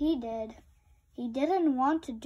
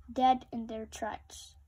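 plain reading voice

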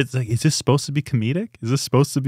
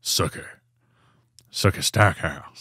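mock smouldering vampire, very soft and low; 6 semitones lower, 6 dB quieter than usual. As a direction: overdo the soap-opera heartthrob. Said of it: "sounds like a bad [name]".

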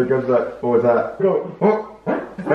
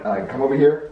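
ordinary delivery

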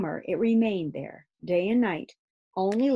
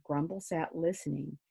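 ordinary delivery

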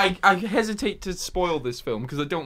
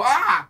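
short startled yelp, higher and louder; mock startled scream; 6 semitones higher, 5 dB louder than usual. Film victim startled by a jump scare, yelp. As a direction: yelp like a jumpy horror victim. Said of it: "then they go".

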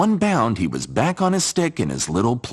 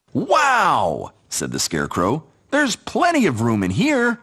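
much higher, amazed wow; delighted scarecrow; 7 semitones higher than usual. Acted. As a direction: gasp with delight.

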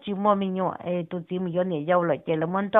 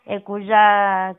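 a slow, drawn-out louder call; 6 dB louder, much slower than usual. Acted. Speaker calls out to someone, drawn-out slow call. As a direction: draw the call out as if to someone far off.

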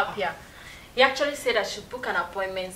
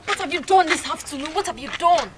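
angry blaming; 6 semitones higher, 7 dB louder than usual. Acted. Wife blames husband.

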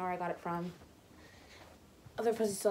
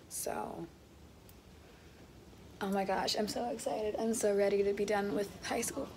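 usual speaking voice

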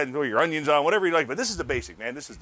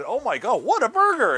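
amazed exclamation; 9 semitones higher, 6 dB louder than usual. Retold or imitated. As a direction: jump way up and louder, wowed by the size. Diners in 1950 marvel at burger.

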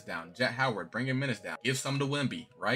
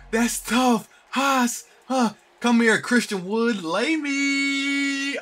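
high, loud whooping hype; hyped celebration; 12 semitones higher, 8 dB louder, much slower than usual. Real, not acted. Gamer celebrating a tough play, hyped shouting. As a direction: yell it out, stretching the last words.

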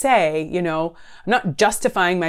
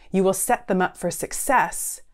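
ordinary delivery

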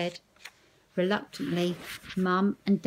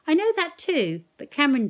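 urging Nan; 9 semitones higher, 9 dB louder than usual. Acted.

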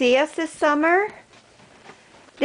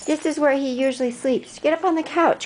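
normal talking voice

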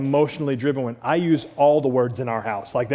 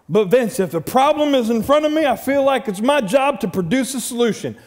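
mock husband's protest, pitched high and loud; protesting objection; 9 semitones higher, 4 dB louder than usual. Husband objecting to the pastor's advice, protesting. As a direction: raise the voice in insistent complaint.

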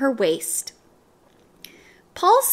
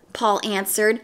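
usual speaking voice

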